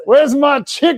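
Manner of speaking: high, loud comic indignation; mock outrage. Playing the man robbed of his chicken, mock outrage. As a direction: demand it in hammed-up outrage.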